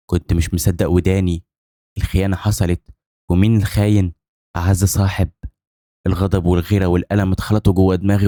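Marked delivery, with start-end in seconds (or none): none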